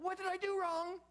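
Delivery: imitated panic. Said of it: "I'm sure Zechariah thought".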